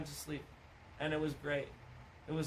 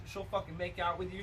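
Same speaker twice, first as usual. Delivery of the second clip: normal talking voice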